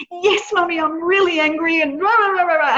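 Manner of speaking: hammed-up grumpy six-year-old whining to mummy; imitating angry daughter. A daughter tells mummy she's angry, in a high mock-cross child voice.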